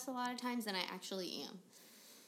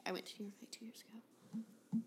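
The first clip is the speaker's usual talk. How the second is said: whispered quote; 11 dB quieter than usual. Retold or imitated. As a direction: whisper it back mockingly. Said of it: "You were like".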